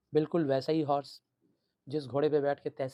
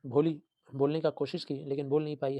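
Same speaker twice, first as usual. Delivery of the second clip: ordinary delivery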